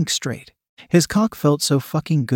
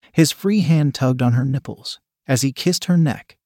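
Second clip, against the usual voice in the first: ordinary delivery, nothing marked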